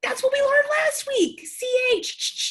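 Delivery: high, loud squeal of realisation; excited shout. Pretend. Teacher reminds pupils of last week, high excited shout.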